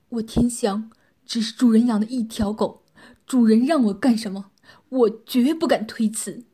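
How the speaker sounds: loud, eager submissive pledge; hurried, frightened. A narrator's reading. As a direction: swear obedience in frightened haste. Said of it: "急忙说道".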